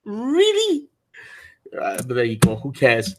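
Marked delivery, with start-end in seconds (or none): 0.0-0.9 high, mock-incredulous really; imitating scornful Twitter replies, high and disbelieving; mocking reply. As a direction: parody the doubters with a squeaky really. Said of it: "some of the replies were like".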